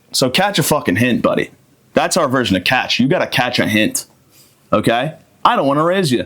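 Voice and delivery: ordinary talk, nothing marked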